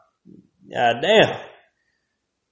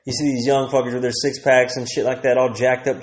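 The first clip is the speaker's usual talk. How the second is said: ordinary talk, nothing marked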